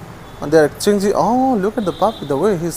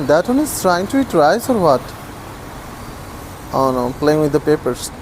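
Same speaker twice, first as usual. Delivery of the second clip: ordinary talk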